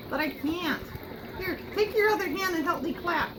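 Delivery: ordinary talk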